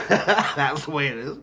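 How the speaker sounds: said through a laugh; slow, laughing admission. Real, not acted. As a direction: let the laugh break into the words. Laughs while boasting of winning.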